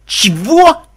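loud, high shocked yelp; startled cry. A narrator's reading. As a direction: cry out in disbelief. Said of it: "вскрикнул Каламити".

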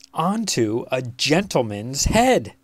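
ordinary delivery, nothing marked

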